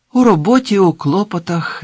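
high, loud, sighing complaint; sighed reply. Acted. Man telling an old acquaintance of his chores, sighing. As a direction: answer with a put-upon sigh. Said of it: "зітхнув".

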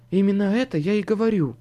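a hint of a woman behind a door; muffled female voice. A narrator's reading. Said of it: "отвечал приглушённый женский голос".

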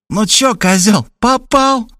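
high, mocking criminal slang; thug's voice. A narrator's reading. An old crook on the phone mocking Robert, sneering. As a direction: sneer at him like a thug. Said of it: "голос с блатницой".

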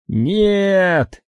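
a high, full-throated yell of "Нет!"; desperate bellow. Acted. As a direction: roar "Нет!" at the top of your lungs. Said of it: "во всё горло заорал он".

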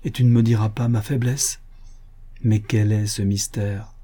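ordinary delivery

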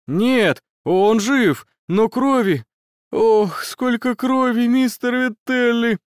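put-on high, shaken, horrified boy's voice. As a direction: cry out in horror at blood. Student horrified by the blood.

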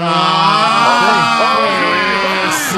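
shouting. Real, not acted. Hosts shout in banter.

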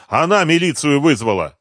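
loud, high accusing alarm. A narrator's reading. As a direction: report it in alarm. Frightened lookout warns the gang.

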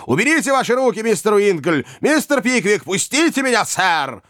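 high, insistent angry orders; angry shouting. A narrator's reading. Old man struggling in his friends' grip, angry shouting. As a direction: cry out to be released, pitch right up. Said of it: "кричал старик".

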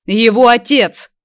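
loud, high, drawn-out angry retort; barked. A narrator's reading. Man snaps at his former classmate, barking. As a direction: bark it out slowly and forcefully. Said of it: "рявкнул тот".

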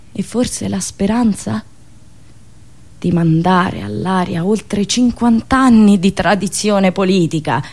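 ordinary delivery

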